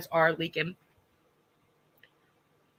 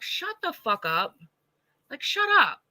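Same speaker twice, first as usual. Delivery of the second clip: exasperated outburst; 7 semitones higher than usual. Real, not acted. Telling men to stay out of it, exasperated. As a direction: fling it out in disbelief.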